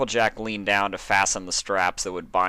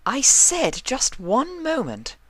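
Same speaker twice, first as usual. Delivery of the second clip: much higher, slow, pointed and impatient; snapped retort; 5 dB louder, slower than usual. A narrator's reading. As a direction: stretch each word out, fed up. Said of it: "Rarity shot over her shoulder".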